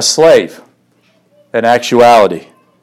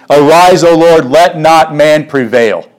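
raised, fervent reading of the prayer; prayed scripture. Acted. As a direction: call it out as a plea to God. Reads prayer of Psalm nine.

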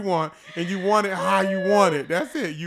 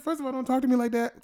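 indignant retort; 8 semitones higher than usual. Retold or imitated. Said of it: "And I said".

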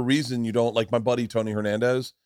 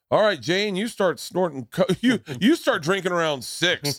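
cocky contestant; 7 semitones higher than usual. Acted. Contestant describing his perfect date to Jane, cocky contestant. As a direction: pitch the date with raised, hammed-up swagger.

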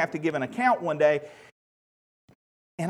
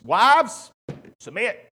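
harsh commanding inflection; 3 semitones higher, 10 dB louder, much slower than usual. Retold or imitated. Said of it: "hear when the passage is read".

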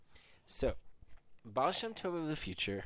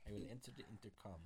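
put-on very low, quick, soft Russian accent; 8 semitones lower, 16 dB quieter, faster than usual. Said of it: "do the accent for Alexei".

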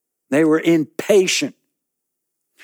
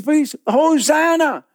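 high, crying plea; desperate plea; 14 semitones higher, 4 dB louder than usual. Acted. Man in the pit calling out to the next passer-by, high desperate plea. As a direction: beg with everything. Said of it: "and you see".

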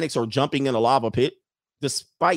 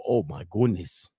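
awed mock gasp; 6 semitones lower, 4 dB quieter than usual. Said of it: "and they go".

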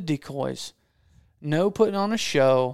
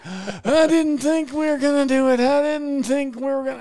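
mock heart-attack panic; 9 semitones higher, 5 dB louder than usual. Hunter laid over holding his chest after the kill, high gasping mock wail. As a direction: imitate him overwhelmed, clutching for breath. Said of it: "He looked like he had had a heart attack".

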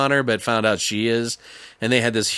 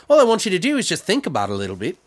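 fast, slightly raised mock voice; quoting; 4 semitones higher, much faster than usual. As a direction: rattle it off like a nagging lecture. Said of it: "He's like".

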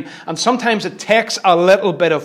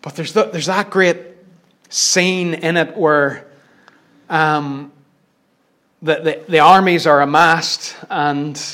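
ordinary talk, nothing marked

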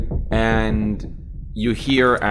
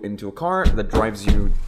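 ordinary talk, nothing marked

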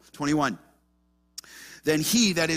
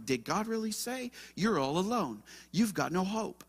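softer, sly tempting whisper of a devil; devil's voice; 7 dB quieter than usual. Acted. The devil whispers doubt to grieving believers, sly and hushed. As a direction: coax like a tempter planting doubt. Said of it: "that devil's gonna come".

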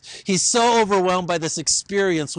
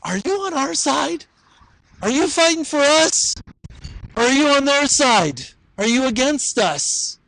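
higher, timid questions to a mighty warrior; nervously asking whose side he's on; sheepish Joshua; 6 semitones higher than usual. Acted. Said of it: "kind of sheepishly says".

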